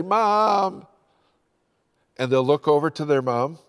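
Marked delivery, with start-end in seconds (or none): 0.0-0.9 higher, mock small child calling "Mom". Grandchild calling for his mom, imitated higher. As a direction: call for mom like a scared toddler. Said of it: "and they'll say".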